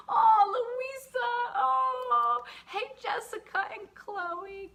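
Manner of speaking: high, loud, drawn-out delighted call; excited greeting. Real, not acted. Spotting a viewer in the livestream chat, excited. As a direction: call out her name with joy.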